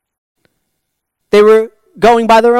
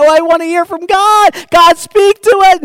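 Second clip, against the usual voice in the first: yearning cry to God; 12 semitones higher, 6 dB louder than usual. Retold or imitated. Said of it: "their hearts would be so dry that".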